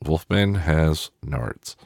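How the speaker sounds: slow, falling, each word weighed out; slow, deadpan. Shown as a demonstration. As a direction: land it slowly as a flat verdict. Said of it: "So Wolfman has nards".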